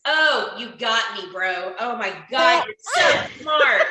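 mock-impressed, stretched out and rising at the end; sarcastic awe. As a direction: gush slowly with heavy sarcasm. Sarcastic reply to mask slogan.

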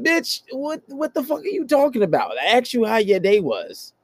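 very high-pitched acted disbelief; incredulous inner retort. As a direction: protest in a high, exasperated voice. Acts out his incredulous inner reply.

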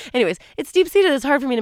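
lively burst. Real, not acted. Laughing off emotion.

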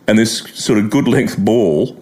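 ordinary talk, nothing marked

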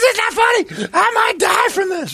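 very high mock shriek; panicked woman impression. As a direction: wail hysterically as the suffering woman. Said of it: "She goes".

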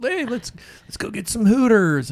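high nerdy voice. Retold or imitated. Nerdy frat guys quoted.

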